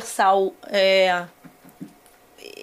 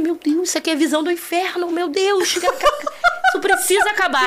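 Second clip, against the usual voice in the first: higher and higher, half laughing, begging it to end; panicked prayer; 7 semitones higher than usual. Retold or imitated. Retelling the roller coaster ride to friends, rising laughing panic. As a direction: retell the ride terror, pitch climbing, slowing at the end. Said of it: "Eu falei".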